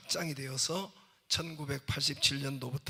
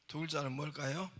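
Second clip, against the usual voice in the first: ordinary delivery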